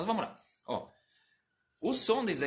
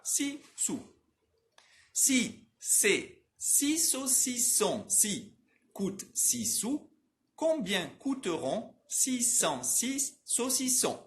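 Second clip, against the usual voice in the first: trava-língua bem lento e agudo, sílaba por sílaba; devagarzinho; 6 semitones higher, much slower than usual. Shown as a demonstration. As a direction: articule cada sílaba com exagero, sem pressa. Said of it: "Eu vou div-, repetir devagarzinho". (bem devagarzinho, articulação exagerada)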